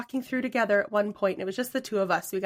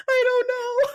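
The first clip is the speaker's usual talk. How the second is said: much higher, panicky near-cry, retold; high, teary mock-panic, louder; panicked teen; 6 dB louder, slower than usual. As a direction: squeak it out like a scared seventeen-year-old. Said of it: "I'm like".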